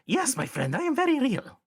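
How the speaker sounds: very high, eager goblin; high-pitched, emphatic friendly goblin; goblin character, delighted. Acted. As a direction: assure them in a high goblin voice.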